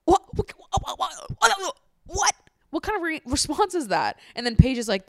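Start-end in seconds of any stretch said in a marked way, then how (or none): 0.0-2.3 repeated "what", climbing much higher; can't believe what you heard; stunned disbelief. Real, not acted. Reacting to his rude reply. 2.7-4.2 outraged disbelief. Real, not acted. Reacting to his rude reply.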